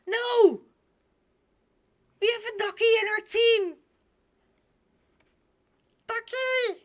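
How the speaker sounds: high, loud excited squeal; excited outburst. Real, not acted. Delighted outburst about a duck.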